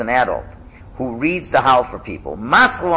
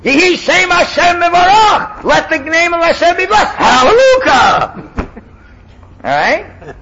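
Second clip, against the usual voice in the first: fiery preacher; 12 semitones higher, 8 dB louder than usual. Shown as a demonstration. Teacher plays the chazan calling out to the congregation. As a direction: preach it like a revival leader.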